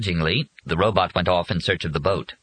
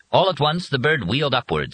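ordinary delivery, nothing marked

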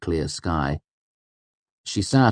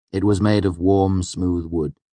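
ordinary delivery

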